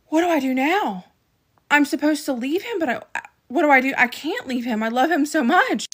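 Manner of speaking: high, loud, distraught outburst; anguished plea. Retold or imitated. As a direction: relive the desperate helplessness. Retelling anguish after being hit.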